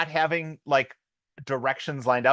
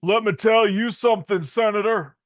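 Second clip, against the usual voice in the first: a hint of Keanu Reeves doing a Jacksonville accent; Keanu's Jacksonville accent; 7 semitones higher, 5 dB louder than usual. Impressing his cohost with a Keanu Jacksonville accent. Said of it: "This is my Jacksonville accent".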